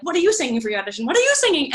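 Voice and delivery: imitating jittery audition-line chatter; nervous, hyper. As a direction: fire off the question fast, pitch climbing in nervous excitement. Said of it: "super nervous and, like, a little bit hyper".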